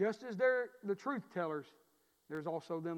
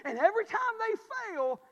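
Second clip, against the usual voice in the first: preaching climax, louder and higher; climactic repeat; 10 dB louder than usual. Shown as a demonstration. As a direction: push the line to a peak. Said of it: "repeated with rising force".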